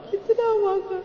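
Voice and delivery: very high, overcome wail; tearful cry. Real, not acted. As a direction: let the voice jump up into weeping. Repeats it, voice breaking upward.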